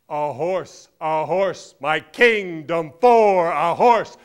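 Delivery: very loud, building theatrical shout; declaimed battle cry. Acted. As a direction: bellow it out, stretching the last phrase. Declaiming Richard's famous battle cry.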